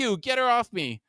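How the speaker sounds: high-pitched alarmed yell. A narrator's reading. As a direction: cry out high, without full volume. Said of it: "Huey yells".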